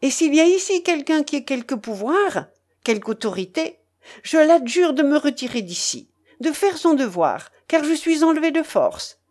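high, urgent public appeal; crying out. A narrator's reading. Young woman begs any authority present to rescue her, crying out. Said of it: "s'écria-t-elle".

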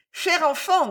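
put-on high-pitched old woman's voice; old aunt aloud. A narrator's reading. Old Laura to her niece before going out, speaking aloud. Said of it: "la vieille le disait à haute voix".

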